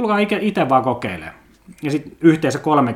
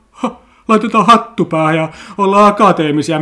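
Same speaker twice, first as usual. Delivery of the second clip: higher, louder, put-on voice of a smug graduate; mock graduate voice; 5 semitones higher, 6 dB louder than usual. Podcaster mocks May Day graduates in a higher, louder put-on voice. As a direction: sneer it out like a pompous new graduate in a cap.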